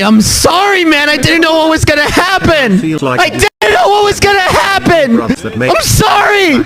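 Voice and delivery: high, shouted mock pleading; frantic apology. Storyteller apologizing to his betrayed friend, frantic shouting. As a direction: wail the apology, hammed-up.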